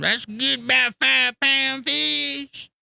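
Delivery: high, loud, very slow belittling drawl; downplay the catch with mock scorn; dismissive Josh. Josh belittles the big bass, loud slow mocking drawl. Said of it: "he looked at me and he said".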